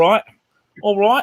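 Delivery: British accent. Shown as a demonstration. Showing off for friends, British accent. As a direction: throw out a hammed-up British catchphrase. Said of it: "get into the accent".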